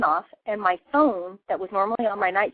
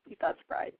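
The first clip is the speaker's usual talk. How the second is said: whispered aside; 12 dB quieter than usual. Real, not acted. Dry aside after the quote.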